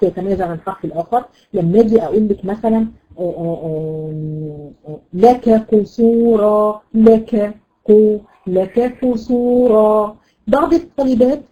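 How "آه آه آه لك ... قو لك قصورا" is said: لك قصورا drawn out slowly; slow recitation. Shown as a demonstration. Teacher models a verse for her students. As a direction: read لك قصورا slowly without rushing into the qaf. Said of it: "هذا خطأ".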